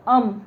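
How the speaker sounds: "अम" said alone as the first syllable; opening sound demonstrated. Shown as a demonstration. Teacher showing students how the word begins, demonstrating "अम" as one syllable. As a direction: sound out "अम", short and separate. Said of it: "क्या पढ़ रहे हैं इसको".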